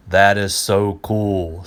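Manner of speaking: loud, slow awestruck that is so cool; impressed friends. Acted. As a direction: gush it out slowly, wowed.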